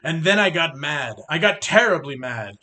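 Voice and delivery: ordinary delivery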